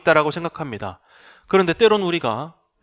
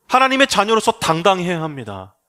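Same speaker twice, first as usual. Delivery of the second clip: ordinary talk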